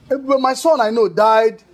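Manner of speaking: forceful, agitated denial; indignant disbelief. Acted. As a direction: loudly refuse to believe the caller. Father disputes caller's claimed identity.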